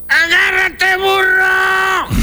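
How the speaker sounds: high-pitched drawn-out shout; urgent calling out. Acted. Ant throwing the rope to the donkey, high urgent shout. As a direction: call it out high to someone in danger. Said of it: "se la aventó al burro y dijo".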